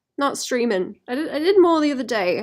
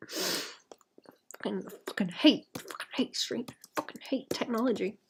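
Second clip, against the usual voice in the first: frustrated whisper; 9 dB quieter than usual. Real, not acted. Cursing at broken stream setup.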